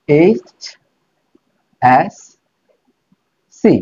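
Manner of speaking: এইচ এস সি said letter by letter, slowly spaced; spelled out letters. Shown as a demonstration. Teacher spells out এইচ এস সি for students. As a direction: sound out এইচ, এস, সি one at a time. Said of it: "এটার উচ্চারণটা কি হচ্ছে".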